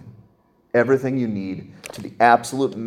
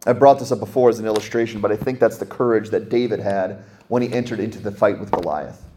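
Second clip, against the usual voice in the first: ordinary delivery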